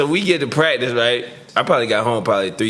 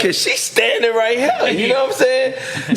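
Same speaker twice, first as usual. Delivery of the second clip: slow and high, laughing through the words; can barely get it out for laughing; real laughter breaking into the telling; 12 semitones higher than usual. Real, not acted. Laughing while telling story.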